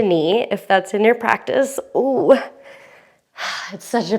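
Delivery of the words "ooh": high, loud little ooh of effort; surprised ooh. Real, not acted. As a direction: let out a strained ooh. Reacting to hard pose.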